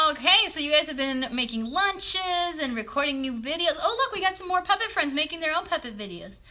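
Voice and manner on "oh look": usual speaking voice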